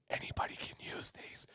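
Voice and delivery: hushed, confidential whisper; whispered aside. Real, not acted. Streamer slips viewers an aside about who can use these, whispered. Aside during giveaway product list.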